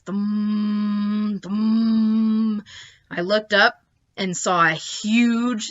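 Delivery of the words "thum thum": imitated humming noise. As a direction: hum like a hovering machine. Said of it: "a noise like this".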